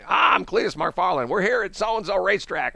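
Cletus McFarland. Retold or imitated. Country guy starting a racetrack interview, high Southern twang. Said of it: "pretend you're a country guy".

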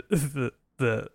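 normal talking voice

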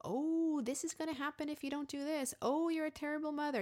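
high, scolding inner critic; worried, shaming voice. Acted. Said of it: "the voice who constantly shames us".

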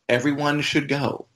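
ordinary talk

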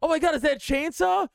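high-pitched mock panic, narrating the attack; comically frantic victim, pitch way up; panicked mobster. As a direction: cry out in hammed-up alarm. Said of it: "the mobsters murdering each other".

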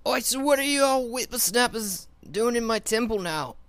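put-on high, loud cranky old hermit; grumpy old codger, barking questions; old prospector.

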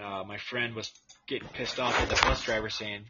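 ordinary delivery

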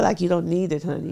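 ordinary talk, nothing marked

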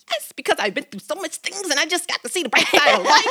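high, fast, bubbly coworker voice; cheerful coworker. Retold or imitated. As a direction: chirp it like the happy coworker. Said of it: "She was like".

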